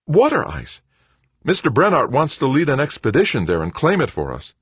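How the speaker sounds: put-on high, loud boyish excitement; bursting to share big news; brimming with enthusiasm. A narrator's reading. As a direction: pitch the idea eagerly. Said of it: "brimming with enthusiasm".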